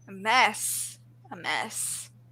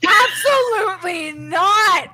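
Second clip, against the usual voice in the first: outraged protest; 6 semitones higher, 4 dB louder than usual. Real, not acted. Host threatening to leave her own stream, outraged protest. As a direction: cry out in theatrical indignation. Outraged at remake of beloved film.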